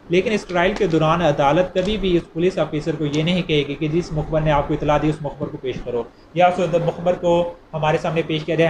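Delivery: ordinary talk, nothing marked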